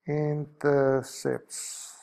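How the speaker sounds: very slow, syllable by syllable; drawn-out word. Real, not acted. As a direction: stretch the word out as if writing it. Drawing out word while writing.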